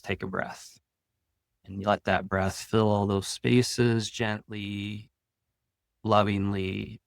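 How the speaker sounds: calm, soothing meditation read with long pauses; guided meditation. Acted. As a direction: ease listeners into their breath, unhurried.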